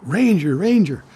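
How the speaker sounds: urgent high, loud hail; imitating a panicked man's shout; alarmed calling out. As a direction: call out to flag down the ranger, high and loud. Alarmed man runs up calling out.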